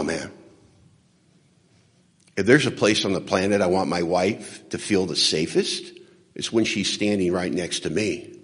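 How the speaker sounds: ordinary talk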